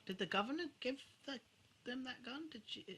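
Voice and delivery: ordinary delivery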